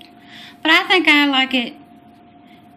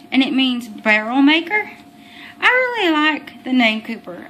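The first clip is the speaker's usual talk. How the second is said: ordinary delivery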